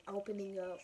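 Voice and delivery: ordinary talk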